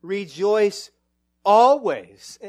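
loud, ringing pulpit declaration; full-voiced sermon call to rejoice; preaching at full force. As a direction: proclaim it to the whole room.

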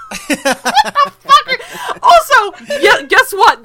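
high, loud, laughing disbelief; laughing outburst. Real, not acted. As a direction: burst out laughing in outrage. Ranting about the Jeopardy rerun.

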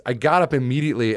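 ordinary delivery

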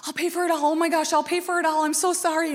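mock frantic sorry; anxious apology. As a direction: plead anxiously to fix it. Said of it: "I'm anxious, I'm worried".

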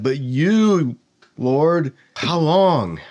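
anguished plea. Shown as a demonstration. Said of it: "Like, I'm terrified".

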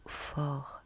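ordinary delivery

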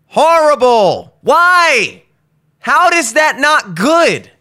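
high, loud, slow outraged complaint; real exasperation. Real, not acted. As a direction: rant at the food that let you down. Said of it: "I'm, like, actually mad".

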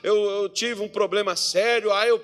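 whining excuses. Retold or imitated. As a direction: pile up excuses like a whiny member.

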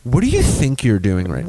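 the sharper take; mock scolding. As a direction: put a scolding edge on it. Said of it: "even better".

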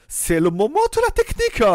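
exaggerated German-accented French exclamation. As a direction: imitate a YouTuber's German accent. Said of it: "avait un accent allemand incroyable".

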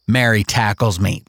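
ordinary delivery

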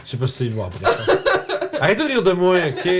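giggling through the words; trying not to laugh; stifled giggles. Real, not acted. Laughing at own slip.

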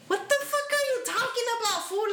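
high, fast incredulous outburst; retold outburst. Talking back to the coworker who accused him: high, fast incredulous outburst.

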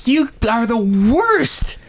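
high, slow exasperated scolding. Retold or imitated. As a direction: drag it out, totally fed up. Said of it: "I'm like".

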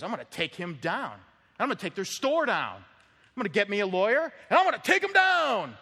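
rising into an angry shout; vengeful threat. Pretend. Preacher voicing a wronged man, building to angry shout. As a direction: build from a grudge to a furious yell. Wicked person's vengeful inner voice.

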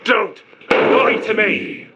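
high, drawn-out angry cry; enraged, high-pitched and slow demand; furious outburst. Acted. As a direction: explode at the liar, stretching the words. Prisoner explodes at interrogator's promise.

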